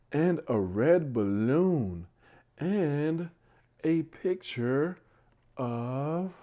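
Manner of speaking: drawing out each rhyming line; bedtime story reading. Acted. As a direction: read it out like a picture book for little ones. Reads bedtime story aloud.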